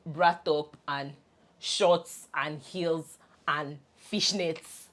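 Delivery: ordinary talk, nothing marked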